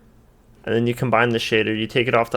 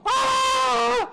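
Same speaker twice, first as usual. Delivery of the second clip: short shrieking scream; hammed-up shriek of pain; high scream. Skit intro, model on fire.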